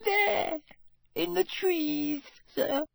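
high, slow, trembling elf voice; frightened house elf. A narrator's reading. Elf tells the wizards where the mark came from, high and scared. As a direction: point into the trees, scared.

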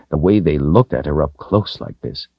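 usual speaking voice

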